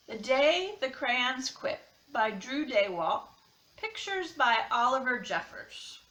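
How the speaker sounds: expressive picture-book read-aloud, crayon letters lightly voiced; reading to children. A narrator's reading. Teacher reading a crayon picture book to her class, lively storytime voice.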